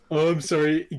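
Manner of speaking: normal talking voice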